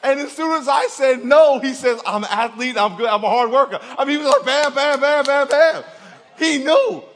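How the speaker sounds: loud, high, excited storytelling; worked-up comic shout; animated retelling. Retold or imitated. Animated retelling of kid's rapid answers.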